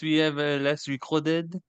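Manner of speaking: mock French accent. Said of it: "we doing French accents".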